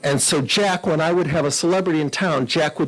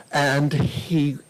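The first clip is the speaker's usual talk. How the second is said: ordinary talk, nothing marked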